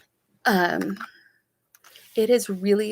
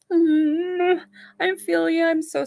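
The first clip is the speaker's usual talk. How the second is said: much higher, cooing I feel you; high sympathetic coo; 9 semitones higher, 4 dB louder, slower than usual. Real, not acted. Sympathy to commenter.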